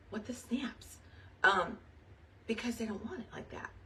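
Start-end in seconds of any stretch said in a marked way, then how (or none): 0.1-1.0 soft, unvoiced mock outrage; whispered exclamation. Real, not acted. Leader frustrated at her team, whispering. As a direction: whisper it like a stifled curse. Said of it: "whispered mild exclamation".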